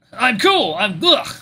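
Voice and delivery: high strained outcry cut off by a grunt; choked protest. Acted. Choked man protests, then submits.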